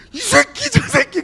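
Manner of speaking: very high, loud playful cursing; swearing buddy. Retold or imitated. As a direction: shriek the insults in mock outrage. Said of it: "엄청 욕을 하는 거예요".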